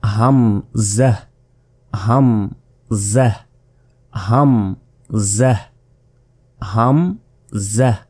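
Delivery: হামজাহ drawn out slowly; টেনে হামজাহ; slow hamza drill. Shown as a demonstration. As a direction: stretch each হামজাহ. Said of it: "এরপর দেখুন".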